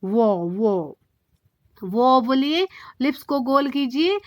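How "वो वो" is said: "वो" with rounded lips, low and soft. Shown as a demonstration. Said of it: "lips को गोल करके हुए बोलना है". (होंठ गोल करके धीमा "वो")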